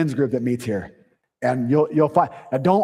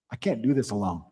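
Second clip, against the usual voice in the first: softer, lower admission of need; humble plea; 4 semitones lower, 8 dB quieter than usual. Shown as a demonstration. Pastor modelling asking for help, soft and lower. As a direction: confess it quietly. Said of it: "The strongest thing you can say is".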